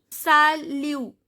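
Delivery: slow "salut" split as sa... lu; "salut" ending on the u; silent final T. Shown as a demonstration. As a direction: say "salut" slowly with no T. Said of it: "T is not pronounced".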